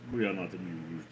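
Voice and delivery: low, quiet, haughty cat voice; grumpy cat voice. Acted. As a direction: mutter it like an unimpressed cat. Cat character line again.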